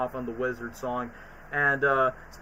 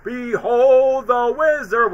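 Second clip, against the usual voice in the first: high, loud, slow intoning; dramatic announcer; 7 semitones higher, 6 dB louder, much slower than usual. Retold or imitated. Introducing the Wizard song, dramatic announcer voice. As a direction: announce it grandly.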